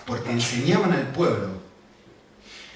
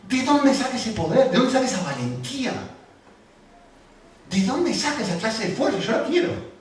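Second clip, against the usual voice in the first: much higher, with a laugh in it, marvelling; amazed wondering; 10 semitones higher, 4 dB louder than usual. Retold or imitated. The preacher voicing amazement at the apostles' courage, high and laughing. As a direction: marvel aloud at their nerve. Astonished onlooker asking where courage comes from.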